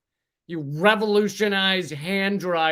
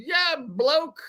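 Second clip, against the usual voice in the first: mock British accent; 9 semitones higher than usual. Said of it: "did it with a British accent".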